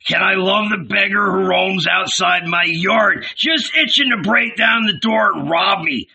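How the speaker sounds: high-pitched indignant rant; angry shouting. A narrator's reading. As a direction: rail at them in mocking outrage. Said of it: "continued to bang his club on the ground and shout".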